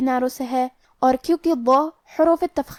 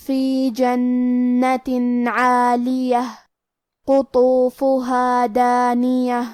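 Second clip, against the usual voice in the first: slow, melodic tajweed chant; Quran recitation; slower than usual. Acted. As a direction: recite each word drawn out like in prayer.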